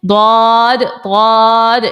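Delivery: ضاد drawn out as a heavy "dawd"; ضاد with a thick Arabic d; side-tongue dad. Shown as a demonstration. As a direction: hold ضاد long, tongue side on the molars. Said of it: "اس کی خوب practice کیجئے گا".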